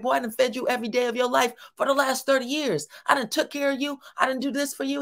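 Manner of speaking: hammed-up angry father listing what he's done; pissed-off dad. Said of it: "dad is pissed again".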